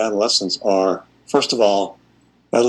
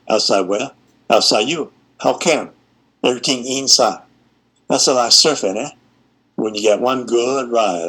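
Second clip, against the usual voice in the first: Hawaiian Pidgin reading. Acted. Teacher reads a Pidgin poem to her class in a light Hawaiian Pidgin lilt. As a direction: read the poem in a mild Hawaiian Pidgin lilt, like a school performance. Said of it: "put on my best eighth grade acting voice".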